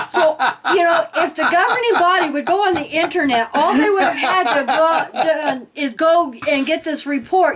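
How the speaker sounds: talking through giggles; high giggly laughing speech. Real, not acted. Laughing while mocking governing body.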